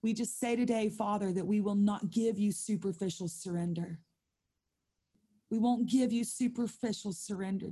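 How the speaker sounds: intercessory prayer to the Lord; earnest prayer. Acted. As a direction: pray it out over the room. Leads the prayer.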